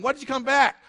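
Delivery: pitched high, demanding; incredulous demand. Acted. Pharisees demanding why the officers returned empty-handed. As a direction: throw the question back in disbelief.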